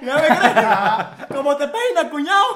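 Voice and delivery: loud mock Veracruz accent through laughter; rowdy, hammed-up costeño impression. Shown as a demonstration. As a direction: echo a coastal Veracruz accent, big and laughing. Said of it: "Ese es el acento costeño por excelencia".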